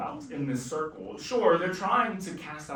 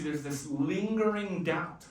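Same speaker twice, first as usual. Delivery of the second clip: usual speaking voice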